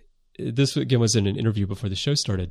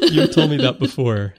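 louder, higher, through laughter; laughing; 5 semitones higher, 6 dB louder than usual. Real, not acted. Host amused by co-host's rant about Colin's accent, laughing. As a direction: say it while laughing.

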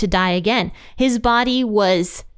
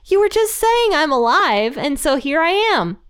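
lightly exasperated, raised pitch; exasperated Jesus; 6 semitones higher than usual. Retold or imitated. Jesus proving to disciples he's alive, mildly exasperated. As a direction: protest playfully, as if it were obvious.

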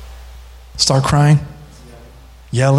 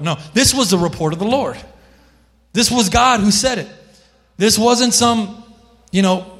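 ordinary delivery

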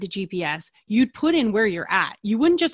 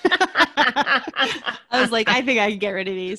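laughing; 3 semitones higher, 4 dB louder, slower than usual. Real, not acted.

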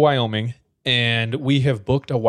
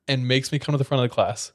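ordinary talk, nothing marked

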